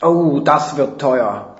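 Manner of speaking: langsam, genüsslich hämisch. A narrator's reading. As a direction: savour it with slow malicious glee. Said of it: "grinst nur hämisch". (hämisch und gedehnt)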